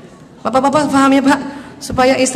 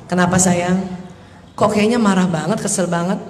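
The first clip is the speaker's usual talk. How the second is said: low, softened gentle questioning; caring mother; 6 semitones lower, 4 dB quieter than usual. Acted. Mother checking on her upset child's feelings, caring. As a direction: ask tenderly, pitched well down. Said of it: "Tanya dulu emosinya".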